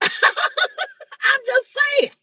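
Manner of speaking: high laughing shout, slowed right down; real amused laughing cry. Real, not acted. Laughs at her own point.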